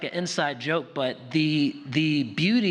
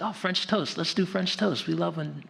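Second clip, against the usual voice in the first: higher, lightly imitated eager kid; eager child's request; 6 semitones higher than usual. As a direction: plead lightly like a hungry kid. Acts out child requesting breakfast.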